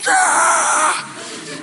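high scream of panic on a bolting horse. Retold or imitated. Pastor on a bolting horse, high mock scream. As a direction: let out a sudden shrill panicked scream. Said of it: "I was".

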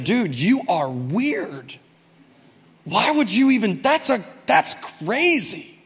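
slow, drawn-out appalled reply; mock disbelief. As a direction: stretch it out as a baffled friend. Friend's appalled reply to the video.

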